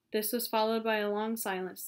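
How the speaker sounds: plain reading voice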